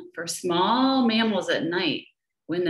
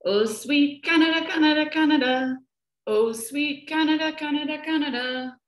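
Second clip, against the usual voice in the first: sung high, lilting bird tune; sing-song like a sparrow; 7 semitones higher, faster than usual. A narrator's reading. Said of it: "add a warm tune".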